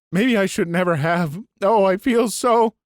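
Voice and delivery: on the verge of crying, pushing the words out; tearful. A narrator's reading. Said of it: "She breaks down, sobbing".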